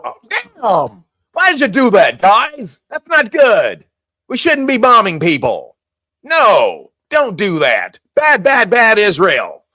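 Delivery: hammed-up, insincere reprimand; Trump, mock scolding. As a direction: scold them as if for show. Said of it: "like he was pissed at Israel".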